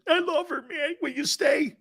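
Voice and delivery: high-pitched mock pleading; whiny pleading lover. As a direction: beg in a high, whiny put-on voice. Mocking a man crying to stay.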